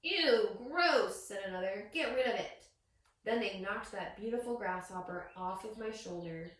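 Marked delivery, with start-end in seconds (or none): 0.0-1.3 louder, higher ew of disgust; disgusted kid. Acted. Classmate recoiling at the grasshopper, loud and higher. 1.9-2.7 louder, pushy demand; a hint of a bossy kid. Classmate demanding the bug go, lightly louder.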